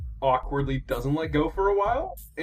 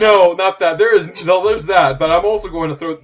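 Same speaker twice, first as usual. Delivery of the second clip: excited outburst; 4 semitones higher, 10 dB louder than usual. Real, not acted. GM excitedly overriding player.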